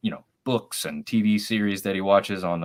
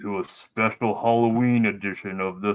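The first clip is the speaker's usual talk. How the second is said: masked villain greeting, echoing; a touch of evil Iron Man; supervillain voice. Pretend. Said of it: "it made you sound like a supervillain".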